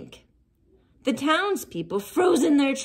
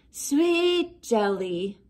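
slow dreamy zombie moan; much slower than usual. Acted. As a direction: moan it slowly like a longing zombie. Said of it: "moaned Reginald".

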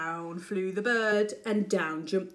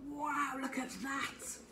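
awed whisper; 4 semitones higher, 10 dB quieter than usual. Real, not acted. Reader marvels aside.

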